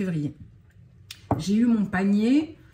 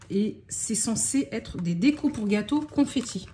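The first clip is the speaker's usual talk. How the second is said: ordinary talk, nothing marked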